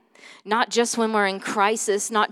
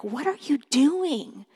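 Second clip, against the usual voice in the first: retold anger; 5 semitones higher, slower than usual. Mom imitating herself mad at her daughter, scolding. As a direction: draw out a restrained mock scolding. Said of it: "then I get mad".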